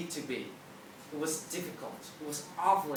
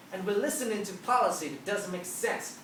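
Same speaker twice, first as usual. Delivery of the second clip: ordinary talk, nothing marked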